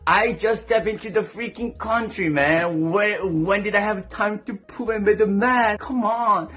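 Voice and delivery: high, exasperated protest; indignant protest. Retold or imitated. Defending himself over the clogged toilet, indignant protest.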